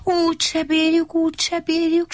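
high, whiny wail; whining lament. Acted. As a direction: moan in despair that it can't be done. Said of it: "sápítozott Vacska Matti". (magas, siránkozó jajveszékelés)

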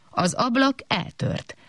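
plain reading voice

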